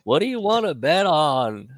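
high, loud mock voice; imitating a friend. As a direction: swoop through it as a caricature. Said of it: "What did he say?".

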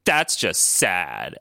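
low, drawn-out mock pity; mocking security guard. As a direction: slowly imitate a guard's lazy put-down. Imitating laughing guard's mockery.

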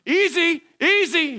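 high-pitched anxious caution, acted out; mock alarm. Stuck kid warning man poking pipe near him, mock alarm. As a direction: plead for care in a squeaky high voice. Pipe jabbed around his legs.

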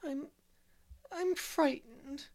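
put-on a frightened little girl stammering through tears; sobbing little girl. Dora at bedtime to Marilla, sobbing and halting. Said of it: "she sobbed".

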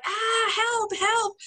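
high pleading imitation of a cry for help; hammed-up panicked help; mock cry for help. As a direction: call out for help through a mouthful, half-joking. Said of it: "and you were like".

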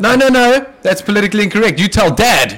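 put-on loud, laughing kid tattling; high whiny sibling threat; childish threat. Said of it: "You know what it reminds me of".

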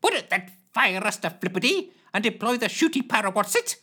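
high eager interjection; Carl Shorten voice. Acted.

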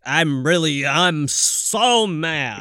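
bad acting, slow and deadpan angry; mock wooden reality-show anger; flat fake anger. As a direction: say you're mad with no anger at all. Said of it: "then it comes out like".

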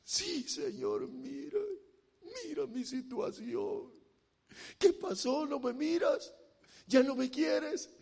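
quietly hammed-up tearful begging; mock tearful prayer. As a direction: imitate a whimpering plea to God. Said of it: "y llora".

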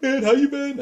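high-pitched friendly hail; hammed-up high hello; excited greeting. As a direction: imitate an old friend happy to catch up. Imitates old friend greeting him.

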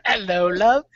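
imitating a British charmer; playful mock British accent. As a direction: echo the put-on British accent. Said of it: "You put an accent on".

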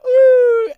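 a very high, loud retching ooh; disgusted ooh. Retold or imitated. Dad with baby vomit in mouth, a high loud yelp.

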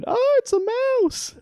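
high mock shriek; mock scream. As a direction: squeal like a cartoon housewife. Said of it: "scream and go".

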